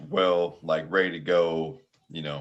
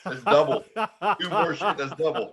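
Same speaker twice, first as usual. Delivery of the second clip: higher, laughing through it; laughing rebuttal; 8 semitones higher than usual. Real, not acted. Answering his friend's outrage, laughing. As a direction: laugh while insisting.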